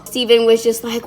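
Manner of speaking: ordinary talk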